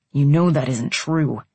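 firm, low-pitched insistence; hard tone. A narrator's reading. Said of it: "his tone hard".

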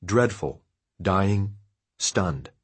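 ordinary delivery, nothing marked

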